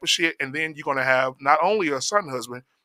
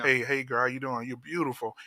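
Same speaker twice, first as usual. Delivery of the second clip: put-on low, soft crooning to a girl; smooth soft pickup line; 4 semitones lower, 12 dB quieter than usual. Mocking men's soft old approach.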